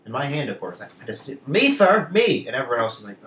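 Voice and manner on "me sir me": high, loud plea to be picked; eager pupil. Retold or imitated. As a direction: burst out like a keen schoolkid. Acts out eager hand-raising pupil.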